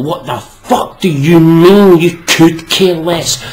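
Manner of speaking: slow, loud disbelief; exasperated outburst. Real, not acted. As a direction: pick the phrase apart in anger.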